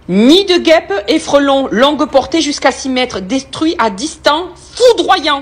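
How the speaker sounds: hammed-up announcer voice; ad read. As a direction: sell the product like a TV ad. Reads the spray can label.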